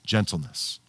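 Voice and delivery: hushed, tender word; soft answer. Shown as a demonstration. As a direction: drop the voice right down on "Gentleness". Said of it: "restore him in a spirit of what".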